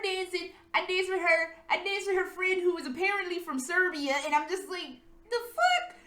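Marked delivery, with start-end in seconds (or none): 5.3-6.0 mock disbelief. Her reaction to Serbia.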